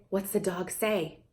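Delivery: quiet coaxing question; soft slow question. Shown as a demonstration. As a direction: ask gently and wait. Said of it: "you could say".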